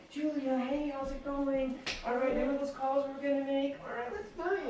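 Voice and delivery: high, bright put-on phone voice; sing-song fake friendliness to a teammate; chirpy fake call. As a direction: mimic a forced cheerful check-in call. Mock chirpy call to a team member.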